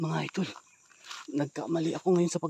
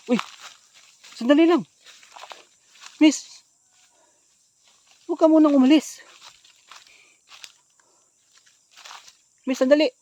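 high, loud shouts after someone running off; calling out; 10 semitones higher, 7 dB louder than usual. Acted. Man calls after the fleeing woman, shouting high. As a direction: yell for her to stop.

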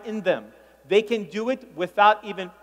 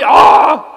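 loud, blurted ah; loud mock "ah"; 5 semitones higher, 14 dB louder than usual. Shown as a demonstration. Comedian mimics a brother's early ah, loud. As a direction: bark out an early ah. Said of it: "One guy started too early".

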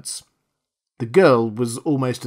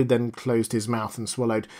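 plain reading voice

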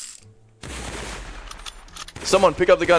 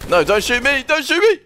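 high panicked pleading; panicking gamer; 8 semitones higher than usual. Real, not acted. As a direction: beg for your life in a panic. Begging guards not to shoot.

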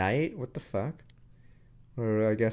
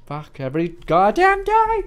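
loud, higher exasperated shout; frustrated yell; 9 semitones higher, 12 dB louder than usual. Real, not acted. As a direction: blow up at the chore. Frustrated outburst.

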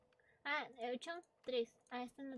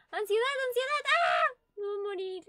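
high, loud anxious outburst rising to a scream; panicked yelling; 8 semitones higher, 12 dB louder than usual. Real, not acted. Player sure of dying in the game, panicked yelling. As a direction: yell as panic takes over.

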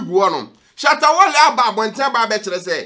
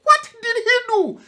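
shocked disbelief; 13 semitones higher than usual. Retold or imitated. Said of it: "And I'm like".

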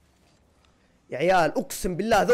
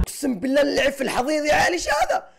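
frustrated shout; 6 semitones higher than usual. Real, not acted. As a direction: yell in disbelief at your own bad play. Outraged at own bad play.

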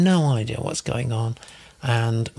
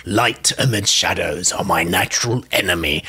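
lower, put-on accent of a dark being; Lex accent; 5 semitones lower, 3 dB louder than usual. Host reads Lex's comment as a being of darkness, imitated Lex accent. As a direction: play up an imitated accent, pitched down. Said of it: "I said that with a Lex accent".

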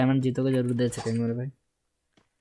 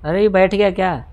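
higher and louder, startled; surprised shout; 5 semitones higher, 6 dB louder than usual. Real, not acted. Gamer startled the enemy got in, surprised shout. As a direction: call it out in surprise. Enemy suddenly sits in vehicle.

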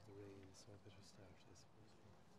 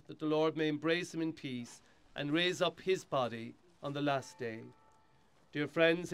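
steady, solemn prayer reading; hushed graveside prayer; 6 semitones higher, 24 dB louder, slower than usual. Acted. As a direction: lead the mourners in prayer. Priest leads graveside burial rite.

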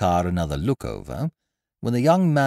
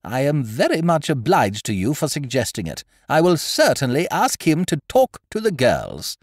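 higher, louder, firm lady's voice; a headmistress who has made up her mind; decided headmistress; 8 semitones higher, 7 dB louder than usual. A narrator's reading. Headmistress promising to book the speaker, in a higher, louder, firm voice.

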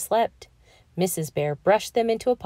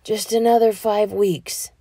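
sleepy begging; 3 semitones higher than usual. A narrator's reading. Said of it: "he begged".

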